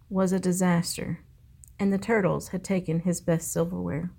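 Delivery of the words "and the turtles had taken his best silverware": ordinary delivery, nothing marked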